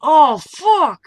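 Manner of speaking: crying out in pain, voice breaking; half-sobbed curse after the fall. Retold or imitated. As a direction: groan the curse like it really hurts. Just woke up bleeding.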